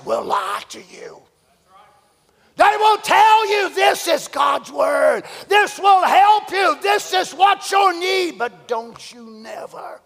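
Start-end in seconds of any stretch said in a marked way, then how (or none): 2.5-8.4 much higher, louder, slow preacher's cry; hammed-up false preacher promise; shouted preaching. Real, not acted. Preaching at full force. 8.4-10.0 softer, slow earnest plea; quiet pulpit warning; hushed warning. Real, not acted. Soft warning after shouting.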